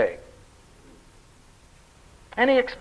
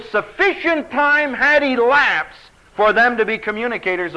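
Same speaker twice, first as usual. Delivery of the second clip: louder, emphatic pulpit delivery; preaching; 4 semitones higher, 4 dB louder than usual. Acted. Pastor explaining the word babe to his church, emphatic. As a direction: drive the point home from the pulpit.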